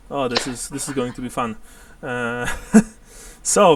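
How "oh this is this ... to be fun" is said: ordinary talk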